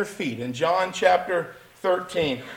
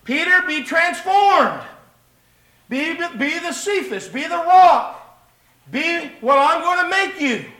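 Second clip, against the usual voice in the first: preaching Jesus' call; 9 semitones higher, 7 dB louder than usual. Acted. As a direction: call Peter out like a prophet. Preacher voices Jesus exhorting Peter.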